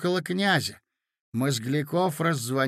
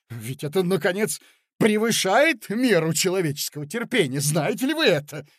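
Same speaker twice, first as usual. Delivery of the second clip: indignant protest; 4 semitones higher, 6 dB louder than usual. Acted. Mozglyakov's angry protest.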